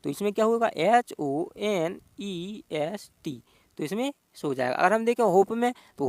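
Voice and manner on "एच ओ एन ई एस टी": एच ओ एन ई एस टी spelled slowly, one letter at a time; spelling letter by letter. Shown as a demonstration. Teacher spells honest for students, slow letter by letter. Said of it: "एच इसमें silent है हॉनेस्ट में".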